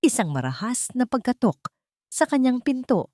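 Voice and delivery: usual speaking voice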